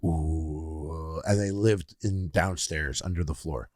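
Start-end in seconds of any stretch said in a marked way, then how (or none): 0.0-1.2 long, low, soft "ooh"; crowd drone imitation. Describing warbling rocks to friends, low droning ooh. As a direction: hum like a crowd under the floor. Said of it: "a million people being like".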